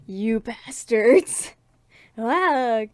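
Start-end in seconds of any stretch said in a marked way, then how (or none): 0.1-1.5 louder, slow, dragged-out curse; big drawn-out battle cry; dramatic yell. Shown as a demonstration. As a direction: roar it out like an anime hero. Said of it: "I should have been like".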